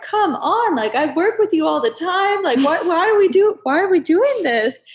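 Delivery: frustrated outcry. Retold or imitated. Frustrated quote at the universe.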